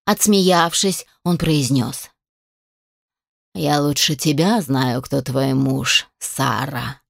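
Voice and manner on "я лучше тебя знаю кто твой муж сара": low, unhurried undead voice; rustling creature voice. A narrator's reading. Undead creature answering the captive about her husband, rustling. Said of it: "ответило существо шелестящим голосом".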